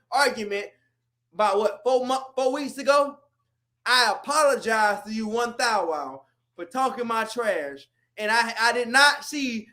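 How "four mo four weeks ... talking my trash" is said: ordinary delivery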